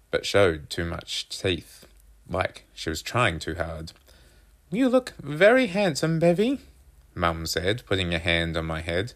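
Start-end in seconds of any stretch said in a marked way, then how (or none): 4.7-6.6 high-pitched, louder mother voice; doting mum. A narrator's reading. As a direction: coo encouragingly as Mum, pitched well up. Narrator voices reassuring mum.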